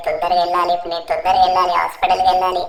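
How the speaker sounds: urgent, anxious muttered-to-self rush; frantic Franklin. Acted. Franklin rushes Chinchan to hospital, urgent and upset. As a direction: reassure the injured friend while panicking.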